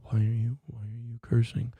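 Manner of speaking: a light, meek imitation of the caller; mock whimper. Imitating the timid caller asking for Coach Mo, mock whimper. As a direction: mumble it like a timid man. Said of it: "They were talking in a whimper".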